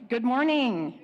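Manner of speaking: loud, bright call of good morning to the hall; loud greeting. Real, not acted. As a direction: greet the whole room loudly. Greets the congregation.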